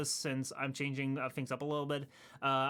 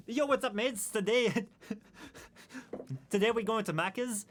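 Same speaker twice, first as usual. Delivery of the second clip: lightly put-on Australian accent, higher pitch; 5 semitones higher than usual. Streamer improvising for chat, mock Australian accent. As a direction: have a go at a hammed-up Australian accent. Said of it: "What else is Australian?".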